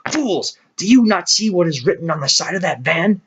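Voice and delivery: angry shout. Acted. Benjamin stamps and berates the animals, angry shout. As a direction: rail at the herd's blindness. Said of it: "shouted Benjamin".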